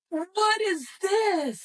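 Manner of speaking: high, slow, stunned cry of disbelief; shocked cry. Retold or imitated. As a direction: gasp out the question in shock. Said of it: "I was like".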